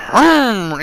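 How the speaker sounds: a quick jokey Hulk growl; mock Hulk growl. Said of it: "he's like the Hulk".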